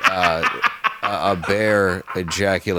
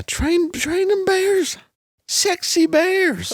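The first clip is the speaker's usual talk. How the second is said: much higher and very slow, a teasing croon; mock sultry voice; much slower than usual. Joking to friends about the bear story, in a slow, high mock-sultry croon. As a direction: stretch it out like a silly come-on.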